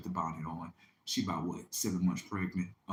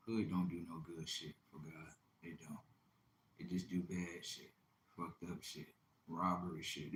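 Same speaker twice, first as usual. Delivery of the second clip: whisper; 5 semitones lower, 8 dB quieter than usual. Real, not acted.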